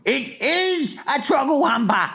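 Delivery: high giant voice, insisting on "is"; exasperated cry. A narrator's reading. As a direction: snap back in exasperation. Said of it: "cried the exasperated B F G".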